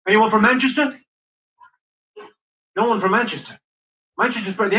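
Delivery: ordinary talk, nothing marked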